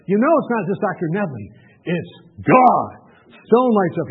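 ordinary talk, nothing marked

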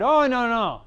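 retold quick, firm protest; dismissive refusal. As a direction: wave the offer away emphatically. Young man refuses the offered Bible.

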